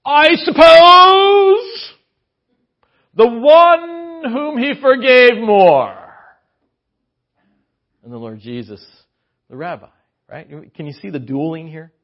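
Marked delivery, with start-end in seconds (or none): 0.0-6.0 very high, loud, slow pompous drawl; arrogant Simon. Acted. Simon grudgingly answering Jesus, pompous and very slow. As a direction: stretch it out with lofty disdain. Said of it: "I can hear Simon".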